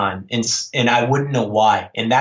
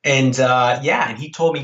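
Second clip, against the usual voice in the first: ordinary talk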